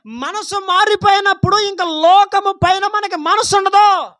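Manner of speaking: loud, high, slow fervent preaching; preaching at full force. Real, not acted. Preacher exhorting the church, loud and fervent. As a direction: thunder it out to the congregation.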